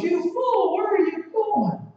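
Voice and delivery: loud, high, slow scornful call; a scorned temptress yelling from the door; Lady Folly scolding. Acted. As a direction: spit the words after them, high and loud. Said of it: "she goes".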